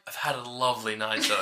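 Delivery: soft polite goodbye. Retold or imitated. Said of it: "she's like".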